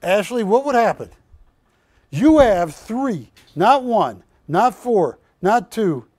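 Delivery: loud, slow, punchy counting; loud slow emphasis; emphatic build-up. Real, not acted. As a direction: hammer each number home. Host lecturing loudly about roosters.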